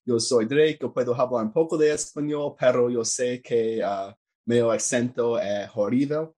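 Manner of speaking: halting Spanish with a strong American accent; American-accented beginner Spanish; bad Spanish accent. Real, not acted. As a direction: speak Spanish as an American learner would. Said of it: "that's how bad my accent is".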